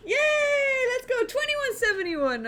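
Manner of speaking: high, loud victory cheer; excited cheer. Real, not acted. Streamer cheers a win and new rating, excited. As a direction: celebrate the win.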